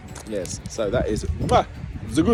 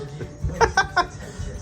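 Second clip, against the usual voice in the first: airy high-pitched giggle; high breathy giggle; 4 dB quieter than usual. Real, not acted. Laughing at greeting own feet.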